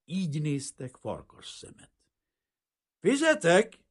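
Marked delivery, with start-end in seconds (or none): 3.0-3.8 high, loud shout; calling out. A narrator's reading. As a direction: call across the room for the bill. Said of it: "kiáltott Aronzon". (hangos, magas kiáltás)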